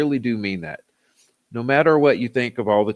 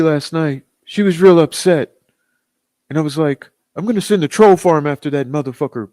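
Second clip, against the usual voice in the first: higher, louder mock voice of a rival streamer; mocking impression of a rival; 4 semitones higher, 3 dB louder than usual. Mocking a rival YouTuber's bragging, in a higher, louder mock voice. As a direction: ham up a rival's voice to mock him. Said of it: "I'm not necessarily an impressionist".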